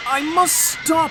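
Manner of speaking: high, loud, slow desperate cry. A narrator's reading. Thomas skidding toward the buffers, desperate. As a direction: strain every word.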